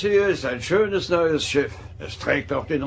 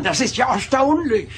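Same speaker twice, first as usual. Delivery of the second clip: mock amazement; 9 semitones higher, 5 dB louder than usual. Old admiral mocks the android.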